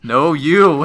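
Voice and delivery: shouted joke comeback; mock comeback. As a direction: throw it back triumphantly, hammed up. Acts out Uno reverse comeback.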